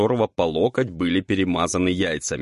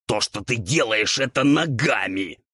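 higher, raspy indignation; indignant ghoul; 4 semitones higher than usual. A narrator's reading. Ghoul objects to devil using his feet, indignant. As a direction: protest in an outraged ghoul rasp. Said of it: "возмутился упырь".

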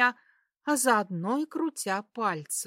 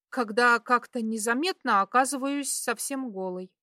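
ordinary delivery

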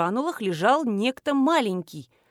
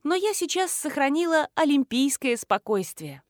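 usual speaking voice

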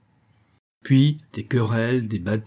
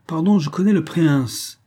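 put-on firm southern Tarascon accent, pitched higher; 6 semitones higher, 3 dB louder than usual. Tartarin defending the prince to the officer, firm Tarascon accent. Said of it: "d'une voix très ferme et de son plus bel accent tarasconnais".

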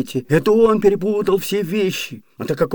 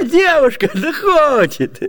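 a shy laugh, then a slow, higher «да хочет»; bashful laugh; 8 semitones higher, slower than usual. Acted. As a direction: chuckle it out, proud and embarrassed. Servant bashfully boasting of girl.

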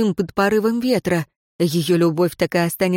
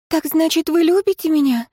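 coy woman; 9 semitones higher than usual. A narrator's reading.

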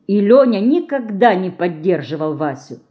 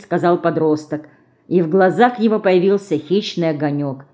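ordinary delivery

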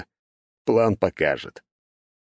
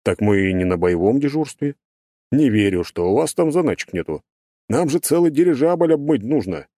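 playful persuading character; coaxing, higher voice; 6 semitones higher than usual. Acted. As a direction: talk him round cheekily. Burbulis persuades.

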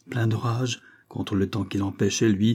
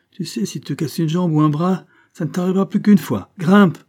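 high, loud, hard-hearted bullying; callous urging; 9 semitones higher, 7 dB louder than usual. A narrator's reading. Banquiste dismisses broken bones, callous urging. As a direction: build to a barked order. Banquiste threatens reluctant child.

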